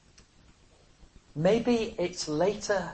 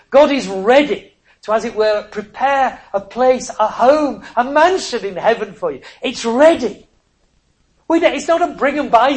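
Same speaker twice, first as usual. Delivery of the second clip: louder, urgent gospel appeal; preaching at full force; 4 semitones higher, 8 dB louder than usual. Real, not acted. Preacher urging his hearers to come, louder and ringing. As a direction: press the invitation home.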